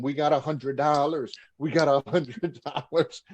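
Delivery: reliving the thrill of a first payday; gleeful celebration. Retold or imitated. Two broke wrestlers celebrating on the hotel bed, gleeful.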